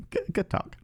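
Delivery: whispered high squeaky good talk; breathy high squeak. Real, not acted. Awkward echo.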